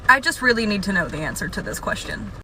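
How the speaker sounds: ordinary talk, nothing marked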